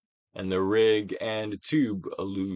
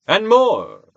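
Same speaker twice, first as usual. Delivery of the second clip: loud urging; 9 semitones higher, 9 dB louder than usual. Acted. Urging more release.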